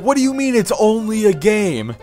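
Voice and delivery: Lucas imitation. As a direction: lightly mimic an incredulous streamer. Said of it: "I love how he's like".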